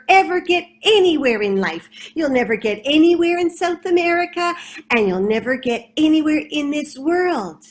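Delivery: cocky rat boasting loudly; boastful rat. Acted. Boastful rat taunting Beetle.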